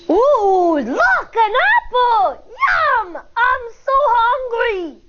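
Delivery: gleeful bully spotting a snack; delighted. Acted. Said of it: "Benny says with delight".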